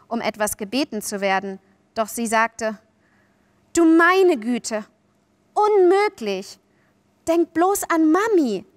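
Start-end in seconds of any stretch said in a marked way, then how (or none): 3.7-8.6 high, loud, drawn-out dismay; overwhelmed, protesting out loud; flustered exclamation. A narrator's reading. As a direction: exclaim in wide-eyed protest, stretching it out. Wendy exclaims at being invited.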